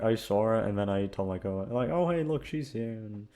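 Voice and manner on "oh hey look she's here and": usual speaking voice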